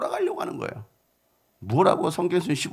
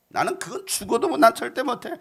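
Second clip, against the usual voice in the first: higher-pitched, whiny refusal; mock stubborn refusal; 6 semitones higher than usual. Patient refusing the remedy even to live, mock stubborn protest. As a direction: refuse as if it's unbearable.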